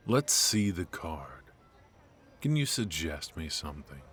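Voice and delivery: usual speaking voice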